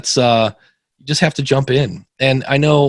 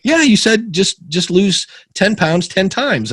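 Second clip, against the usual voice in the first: much higher and louder, then slowing down to spell it out; eager retold reply; 9 semitones higher, 3 dB louder, slower than usual. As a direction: replay your own eager answer, stretching the last part. Retelling his eager reply to listener.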